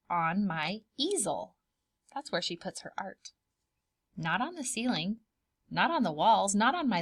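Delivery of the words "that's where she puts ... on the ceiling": usual speaking voice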